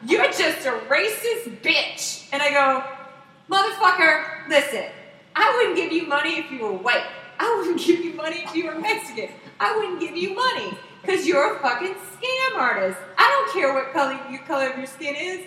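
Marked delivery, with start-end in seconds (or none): 0.1-2.3 slow, higher mock accusation; imitated angry retort. Panhandler retorting to the rider, mock outrage. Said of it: "his retort is". 3.5-15.4 raised, emphatic retold tirade; acted angry comeback. Rider answering the scammer's accusation, acted anger.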